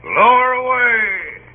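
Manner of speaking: loud, slowed call; commanding shout. Acted. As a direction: bellow the order down the deck. Orders the boat lowered.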